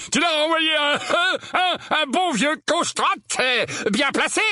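high, halting, loud-voiced uncle; booming voice. A narrator's reading. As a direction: grope for words in a big high voice. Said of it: "d'une voix sonore". (voix sonore, aiguë et hésitante)

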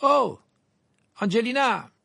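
high, loud call across the room. A narrator's reading. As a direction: shout to get her attention. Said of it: "Il se retourna et s'écria".

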